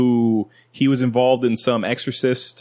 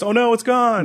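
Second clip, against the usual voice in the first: hammed-up stage magician patter, pitched high; mock magician; 10 semitones higher than usual. Mocking a magician's card trick, high hammed-up patter. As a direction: play the cheesy trickster revealing a vanished card.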